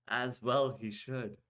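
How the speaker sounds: usual speaking voice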